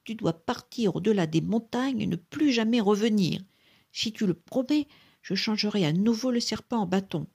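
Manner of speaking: put-on high, insistent old beggar; stern command. A narrator's reading. Beggar dictates terms to genie.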